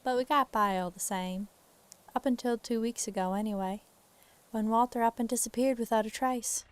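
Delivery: Southern lady, pitched slightly up; Southern accent. Acted. Farm wife on her missing husband, Southern accent. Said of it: "doing a Southern accent for a lady".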